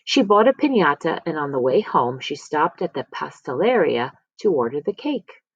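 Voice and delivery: plain reading voice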